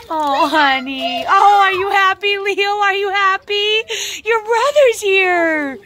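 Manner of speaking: weepy pet talk; teary joy. Real, not acted. Crying over reunited cats.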